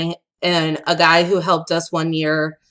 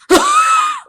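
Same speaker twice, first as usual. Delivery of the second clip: sudden high squeal of laughter; burst of laughter; 5 dB louder than usual. Real, not acted. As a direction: laugh out loud, high and bright. Laughing at her own story.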